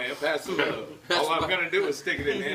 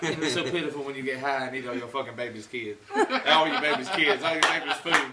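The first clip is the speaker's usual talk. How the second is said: Katt Williams impression; 3 semitones higher than usual. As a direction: rant like a wound-up stand-up comic. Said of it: "Please do Cat Williams".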